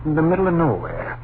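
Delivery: irritated outburst in the night; exasperated. Acted. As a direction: shout the silly idea down. Husband angrily rejects ghost talk.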